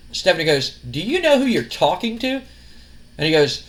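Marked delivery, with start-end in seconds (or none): 0.9-2.4 higher-pitched, rising incredulous question; indignant challenge. Retold or imitated. Wrestler's boss challenges a cocky rival, indignant. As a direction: imitate an affronted woman, pitched up at the end. Stephanie challenges Jericho in acted quarrel.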